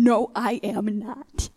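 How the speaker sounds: slow, drawn-out retold refusal; firm refusal. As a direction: dig your heels in, stretching each word. Refusing God in retold exchange.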